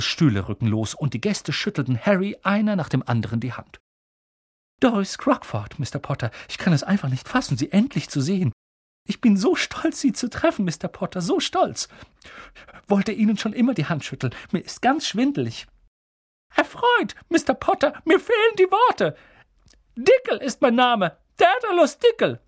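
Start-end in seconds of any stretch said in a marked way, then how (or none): 4.8-15.7 high-voiced, fluttery fan; giddy, overawed woman; gushing admirer. 16.6-22.4 very high, loud, squeaky introduction; excited little man. Acted.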